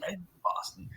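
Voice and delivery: near-whispered mimicked introduction; hushed imitation. Golfer giving only his first name, hushed imitation. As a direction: say it very softly, almost all breath.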